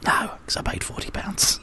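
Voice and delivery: hushed, voiceless aside; a breathy stage whisper of an excuse; mock whisper. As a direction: whisper it like a guilty confession. Audience member's retold excuse.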